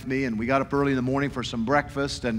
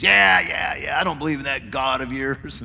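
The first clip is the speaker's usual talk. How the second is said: gruff lowered mock of a dismissive old man; grumpy old man. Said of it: "he was just filled with anger".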